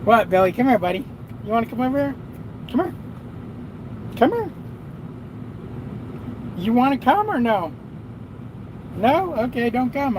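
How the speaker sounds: coaxing Billy the dog to come over; talking to the dog. Real, not acted. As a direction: sweetly call the dog over.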